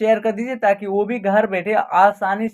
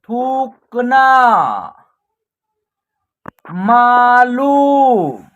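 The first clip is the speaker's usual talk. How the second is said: slow, stretched syllables on a level pitch; drawn-out word drill; 4 dB louder, much slower than usual. Shown as a demonstration. Teacher reading out a Hindi word list for practice, drawn out. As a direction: read each word slowly, holding the long vowels.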